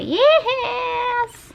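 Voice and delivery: high, squealing yes; excited. Real, not acted. Artist cheering at her finished drawing, squealing yes.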